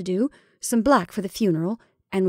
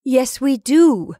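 slow, loud, raised-pitch insistence; peremptory; 5 semitones higher, 8 dB louder, much slower than usual. A narrator's reading. Mrs Brigham overruling her sister about the lamp, peremptory. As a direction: overrule firmly, leaning on every word. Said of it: "returned Missus Brigham peremptorily".

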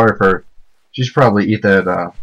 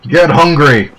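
loud, higher demanding shout; frustrated yell; 6 semitones higher, 5 dB louder, slower than usual. Real, not acted. Player yelling at characters who won't eat, frustrated shout. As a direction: bark the order at the crowd.

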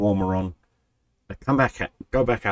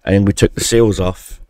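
said again, much louder and firmer; louder restart; 9 dB louder than usual. Real, not acted. Restarting after bumping the mic, louder. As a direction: push the line out loud after the fumble. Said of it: "Keep hitting this fucking microphone".

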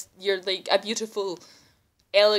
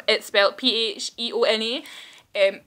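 ordinary talk

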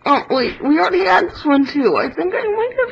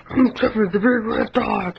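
big dog voice; 7 semitones lower, 4 dB quieter than usual. Pretend. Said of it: "One hundred impressions".

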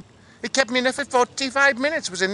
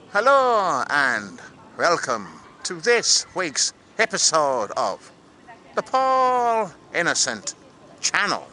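put-on slow Irish-accented show intro; Irish host voice, drawn-out title; 3 semitones lower than usual. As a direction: welcome viewers in a measured Irish accent.

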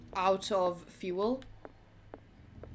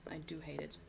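breathy whispered aside; whispering; 8 semitones lower, 16 dB quieter than usual. Real, not acted. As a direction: whisper it to yourself. Whispered repeat of complaint.

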